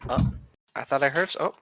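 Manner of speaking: ordinary talk, nothing marked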